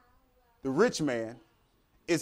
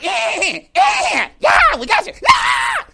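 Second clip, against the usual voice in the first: shrieking, high cackling demon taunt; 10 dB louder, much slower than usual. Acted. Demons in hell jeering at the damned, high shrieking cackle. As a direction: gloat like a jeering little demon, much higher and louder. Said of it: "the little demons are down there going".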